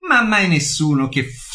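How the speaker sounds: ordinary talk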